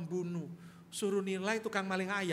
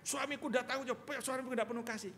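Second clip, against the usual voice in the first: whiny wife; 6 semitones higher than usual. Retold or imitated. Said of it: "Kamu ngomong".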